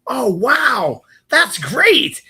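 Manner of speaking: high, bright put-on interest; fake enthusiasm. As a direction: gush to sound interested. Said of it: "spend five minutes going".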